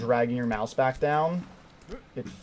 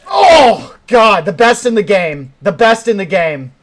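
loud, higher gloating cheer; triumphant bragging; 6 semitones higher, 10 dB louder than usual. Real, not acted. Streamer gloating loudly to chat after a success. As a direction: crow about being the best.

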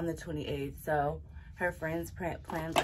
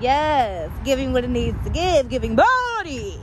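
loud, high hype; louder and higher, hyping the outfit; 5 semitones higher, 12 dB louder than usual. Real, not acted. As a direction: gas her up.

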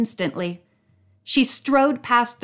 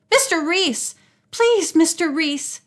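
higher, pleading call; 7 semitones higher than usual. A narrator's reading. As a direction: call to him from the doorway. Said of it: "Nancy called from the doorway".

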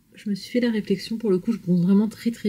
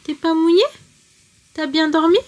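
sleepy husky good morning; 8 semitones higher, 3 dB louder than usual. Real, not acted. Still waking her toddler.

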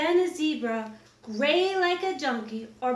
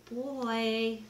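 ordinary delivery

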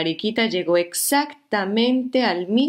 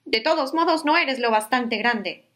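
higher, huffy little bug voice; grouchy ladybug; 6 semitones higher than usual. Acted. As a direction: brush it off like a cranky ladybug. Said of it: "le dijo la mariquita malhumorada".

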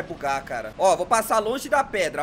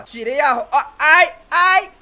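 pained ai; 3 semitones higher, 9 dB louder, slower than usual. Real, not acted. Truck glitches while he drives.

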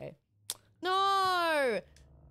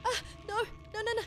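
panicked whimpering; 11 semitones higher, 3 dB louder, much faster than usual. Real, not acted. Chased, repeated no's.

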